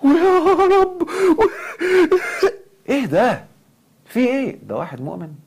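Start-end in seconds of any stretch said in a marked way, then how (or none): 0.0-2.6 very high, loud fake sob breaking into laughter; fake sobbing. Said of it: "أمثل إني ببكي؟". 2.9-4.6 mock shock. Real, not acted. Reacting to fake crying.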